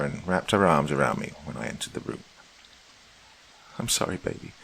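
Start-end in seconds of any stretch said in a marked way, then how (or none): 3.8-4.5 soft, breathless weeping apology. A narrator's reading. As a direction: sob the apology almost voicelessly. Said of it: "she wept".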